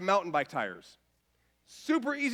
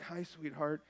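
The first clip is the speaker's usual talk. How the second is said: groggy soft greeting; 5 semitones lower, 11 dB quieter than usual. Retold or imitated. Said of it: "I was like".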